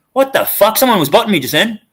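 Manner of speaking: real jolt of surprise at the screen; startled outburst. Real, not acted. Sudden glitch on stream counter.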